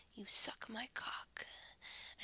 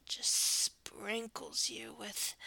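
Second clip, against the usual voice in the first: ordinary talk, nothing marked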